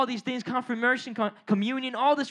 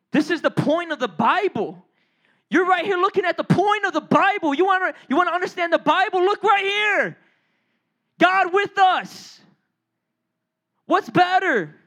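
high, forceful pulpit proclamation; full-force preaching; 5 semitones higher, 4 dB louder than usual. Real, not acted. Preacher pointing listeners to the point of the Bible, full force. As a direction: drive it home to the congregation.

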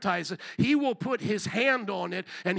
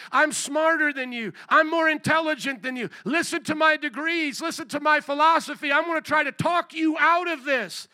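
higher, insistent know-it-all voice; mock boasting; 6 semitones higher, 4 dB louder than usual. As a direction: pile up the boasts, pushing harder each time. Acts out boastful intellectuals.

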